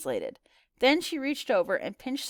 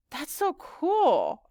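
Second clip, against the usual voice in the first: high, very drawn-out gush; wowed, slow and high; awed admiration; 6 semitones higher, much slower than usual. Acted. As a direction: stretch the words in wonder. Girl awed by friend's languages.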